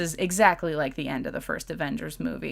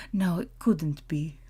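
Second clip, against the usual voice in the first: put-on terrible German accent. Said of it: "That was my terrible, terrible two-second German accent".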